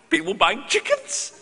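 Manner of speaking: high, laughing through the words; laughing disbelief. Real, not acted. Laughing to the audience about people buying chickens. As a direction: crack up at the absurdity.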